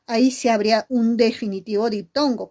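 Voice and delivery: normal talking voice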